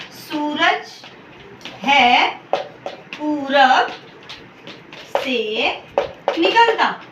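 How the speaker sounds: सूरज है पूरब से निकलता with long pauses between words; slow word-by-word dictation. Shown as a demonstration. Teacher dictating the wrong sentence word by word to students. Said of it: "please ध्यान से देखिए".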